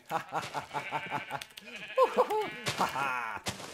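high, loud gleeful cackle; maniacal laugh. Acted. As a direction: cackle like a villain. Laugh after killing.

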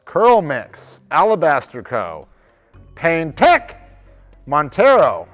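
projected roll-call of names; list announcer. Real, not acted. Host reading out a list of companies, announcing. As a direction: call out each company name with weight.

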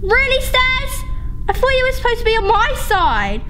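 high, exasperated complaint; mock betrayal. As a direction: scold an ally who has let you down. Scolding the uncooperative game stairs.

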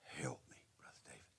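faint breathy whisper; a broken woman's whispered plea. Retold or imitated. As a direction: whisper it right into his ear. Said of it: "She whispered right in my ear".

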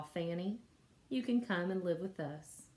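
usual speaking voice

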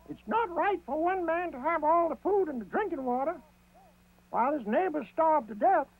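high rabbit voice, indignant; Brer Rabbit. A narrator's reading. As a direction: protest the unfairness as a little rabbit. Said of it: "said Brer Rabbit".

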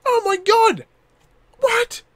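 high, excited squeal of discovery; high-pitched real amazement; amazed excitement. Real, not acted. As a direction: gush over the new guns. Discovers new classes, amazed.

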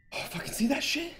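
high hushed whisper. Real, not acted. Streamer whispering to chat after a scare. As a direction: hiss it under breath.

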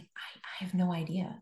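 whispered, barely voiced; hushed confusion at the altar; bewildered whisper. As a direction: breathe it out, lost for words. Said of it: "And I was like".

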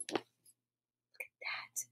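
usual speaking voice

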